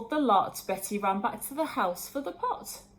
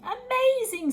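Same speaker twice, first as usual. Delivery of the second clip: high, loud gasp of wonder; amazed; 13 semitones higher, 5 dB louder than usual. A narrator's reading. Betty amazed as the pot starts simmering, high and loud. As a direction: burst out in delight.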